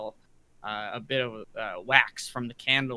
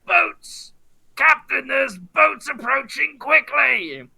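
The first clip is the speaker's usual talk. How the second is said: high, loud lookout's cry; urgent shout, pitched far above talk; yelled alarm; 12 semitones higher, 8 dB louder, much slower than usual. Acted. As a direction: call the warning down from the masthead, stretching the words. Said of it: "he'll start yelling".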